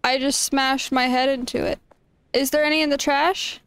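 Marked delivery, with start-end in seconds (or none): none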